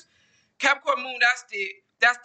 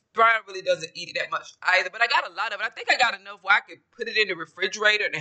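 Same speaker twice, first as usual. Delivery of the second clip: usual speaking voice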